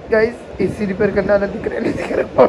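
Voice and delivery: words rushed out through laughter; fast, giggling through the words; laughing while talking. Real, not acted. Wife teasing husband to viewers, laughing through words.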